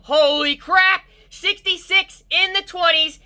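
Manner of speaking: can't contain the excitement; real excitement. Real, not acted. Tester telling viewers about his score, breaking high with excitement. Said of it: "I'm getting so excited right now".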